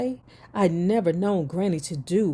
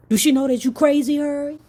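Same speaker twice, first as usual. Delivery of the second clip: higher, louder, fast and incredulous; loud mock outburst; 7 semitones higher, 6 dB louder, faster than usual. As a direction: blurt it out in disbelief. Mocking the grandmother's thoughts.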